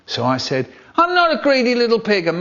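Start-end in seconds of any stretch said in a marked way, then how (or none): 1.0-2.3 put-on high, loud childish protest; indignant child. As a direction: protest like a hurt little boy.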